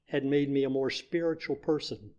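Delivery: ordinary talk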